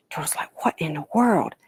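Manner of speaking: hushed, breathy storytelling whisper; whispered suspense. A narrator's reading. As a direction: whisper it as the danger nears. Turtle sees creature coming.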